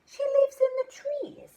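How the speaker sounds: high, loud amazed question; butterfly struck by the clue; surprised question. Acted. Butterfly surprised the mum lives in the trees, high and loud.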